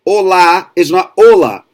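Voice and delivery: olá with the stress on the final lá; o-LÁ with an open final a. Shown as a demonstration. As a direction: hit the lá at the end of olá. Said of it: "la is the strong syllable".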